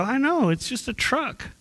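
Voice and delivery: high, offhand shrug of a reply; breezy shrug. Retold or imitated. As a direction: toss it off like it's nothing. Retelling his shrug at wrecked truck.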